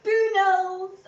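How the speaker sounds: high, loud call; sixteen-year-old calling her dog Boodles. Retold or imitated. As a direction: call the dog's name across the room. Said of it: "I was like".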